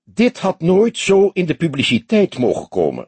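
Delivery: high, put-on voice of a stern chief; high, indignant rebuke; stern commissaris. A narrator's reading. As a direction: reprimand in a high, affected voice. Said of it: "sprak hij streng".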